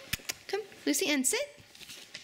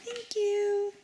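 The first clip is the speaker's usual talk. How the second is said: ordinary delivery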